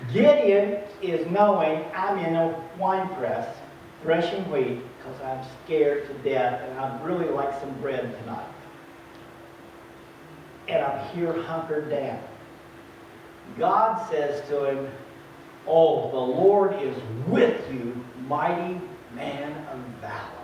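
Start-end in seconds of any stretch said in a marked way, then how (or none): 2.0-12.2 low, softer, hunkered-down murmur; lightly voiced frightened Gideon; mild scared hiding. 15.7-20.4 tender reassurance. Acted. God repeats greeting to Gideon.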